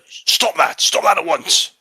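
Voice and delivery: high, loud command to halt; official shouting stop. Acted. Customs official yells at brawlers to stop, loud. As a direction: bark it out like an angry customs officer. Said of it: "He says".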